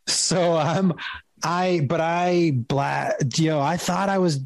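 usual speaking voice

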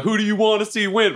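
hyping up the audience; playing to the crowd. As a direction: work the crowd like a wrestler. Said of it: "I'm playing to the crowd".

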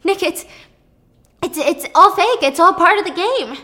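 high, loud, panicked pleading; frantic, scrambling to explain; rising panic in every word; panicking. Retold or imitated. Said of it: "She starts panicking".

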